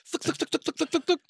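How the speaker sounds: rapid, even mouth-popping noises; imitated alien letter-shooter. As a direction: fire off quick repeated thuks like a sorting machine. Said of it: "in his mouth going".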